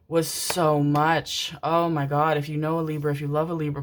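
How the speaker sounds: ordinary delivery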